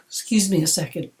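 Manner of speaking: ordinary talk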